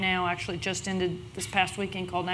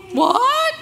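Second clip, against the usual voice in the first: much higher, loud squeal of disbelief; shocked disbelief; 10 dB louder than usual. Retold or imitated. Hearing they made the cut, squealing shocked disbelief. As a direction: shriek it like you can't believe it.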